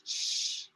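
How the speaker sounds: a whispered "shh"; shushing sound. Shown as a demonstration. Teacher showing the SH sound in "compassionate". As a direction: hiss out a long "sh". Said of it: "This S I is like S H".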